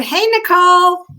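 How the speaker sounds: light up as she appears; excited greeting. Real, not acted. Host welcomes Nicole into the broadcast, excited. Greeting a viewer joining live.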